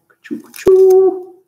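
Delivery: high, loud, slow scissor sound effect; playful cutting noise for children. Pretend. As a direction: snip sounds for the kids.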